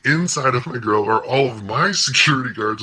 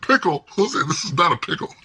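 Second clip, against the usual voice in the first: high-pitched disbelieving retort; retold incredulity; 7 semitones higher than usual. Client to lawyer downplaying the standoff, mock-incredulous retort. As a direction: throw it back in comic disbelief.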